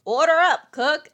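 loud bossy kitchen call. Retold or imitated. Manager calling order loudly to the cook. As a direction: bark the order across the kitchen.

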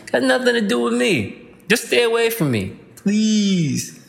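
high, pleading brush-off; dismissive plea. Real, not acted. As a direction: shoo them away, pitched high. Waving off recession believers.

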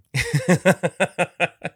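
loud, high burst of laughter; amused laugh. Real, not acted. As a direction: laugh out loud in a rapid run. Amused by the name confusion.

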